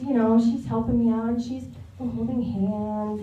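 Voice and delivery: ordinary delivery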